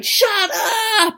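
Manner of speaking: drawn-out, high, loud "shut up"; mock exasperated yell. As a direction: burst out as if at your limit. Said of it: "I was feeling like going".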